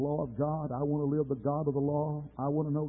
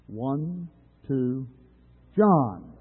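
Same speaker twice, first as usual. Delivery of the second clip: practice call; 2 semitones higher, 9 dB louder, much slower than usual. Shown as a demonstration. Preacher rehearsing the congregation's name shout, restrained call. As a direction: count off, then a raised name. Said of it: "practice run so we're not so loud".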